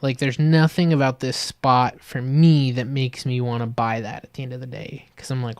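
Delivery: ordinary talk, nothing marked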